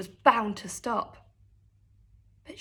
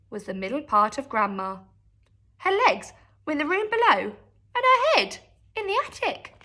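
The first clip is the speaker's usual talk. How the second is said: ordinary delivery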